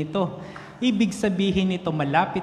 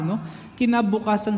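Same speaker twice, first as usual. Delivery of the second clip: normal talking voice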